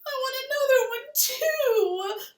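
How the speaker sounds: high, loud, slow wail; loud sobbing. Acted. Little Laura sobs loudly to Ma, wailing. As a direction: sob it out loudly like a crying child. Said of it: "Laura sobbed loudly".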